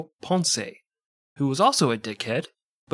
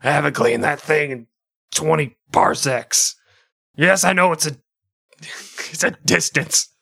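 Jabba the Hutt; 3 semitones higher, 4 dB louder than usual. Pretend. Continues Jabba voice.

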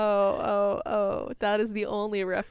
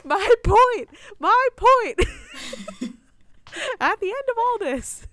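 excited build-up; 11 semitones higher, 9 dB louder than usual. Real, not acted. Building to the episode's big finish.

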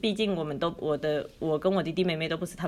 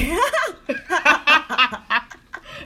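long, loud, higher laugh; 8 semitones higher, 7 dB louder than usual. Real, not acted. Guest joking she'd break down over trolls, laughing loudly. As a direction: burst out laughing, louder and higher.

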